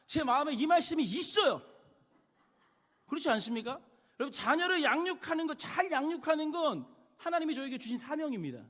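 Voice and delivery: loud, high preaching at full force; fervent preaching. Acted. A pastor presses the church on raising children, fervent preaching. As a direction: drive the verse home to the congregation.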